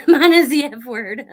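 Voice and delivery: loud laugh running into the words. Real, not acted. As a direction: say it through a burst of laughter. Laughing while reading comment.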